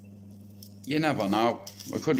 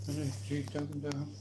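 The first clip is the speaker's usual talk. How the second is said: much softer, hushed calming; 13 dB quieter than usual. Real, not acted. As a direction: hush them gently, almost under your breath. Calming two animals nearby.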